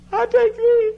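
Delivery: very high, loud squeal; squealing bride. Retold or imitated. Bride squealing her vow at the wedding, high and loud. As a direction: shriek the vow with glee. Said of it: "And she said".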